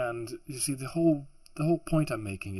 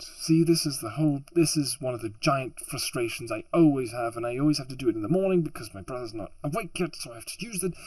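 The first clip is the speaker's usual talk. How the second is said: louder, higher-pitched exasperated rant; real frustration; 4 semitones higher, 4 dB louder than usual. Real, not acted. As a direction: vent your mounting frustration. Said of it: "you can hear my frustration".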